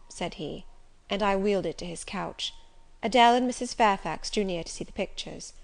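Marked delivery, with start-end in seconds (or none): none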